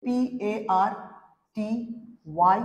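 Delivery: পিএআরটিওয়াই spelt out letter by letter, very slowly; slow spelling. Shown as a demonstration. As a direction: read out each letter for the class to copy. (ধীরে বানান করে বলা)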